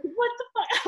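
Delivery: high, startled exclamation; shocked. Retold or imitated. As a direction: gasp it in disbelief. Shocked at friend's words.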